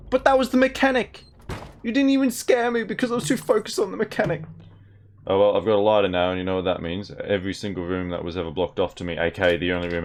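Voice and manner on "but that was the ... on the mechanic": much higher, shocked yelp of words; high-pitched startled protest; shrill startled outburst. Real, not acted. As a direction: blurt it out after a fright. Reacting to a jump scare.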